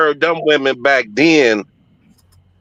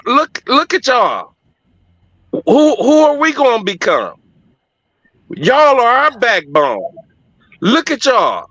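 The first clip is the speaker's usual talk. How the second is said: imitating pleading church women; 4 semitones higher than usual. Church woman on stage pleading with homeless men, raised impassioned voice. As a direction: preach it like a woman on stage begging the men to rise up. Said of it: "And they was like".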